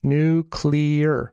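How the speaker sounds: NUCLEAR drawn out with CLEAR stressed. Shown as a demonstration. Showing the syllable the other got wrong, stress on clear. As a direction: lean hard on the clear in Nuclear. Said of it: "with the emphasis on clear".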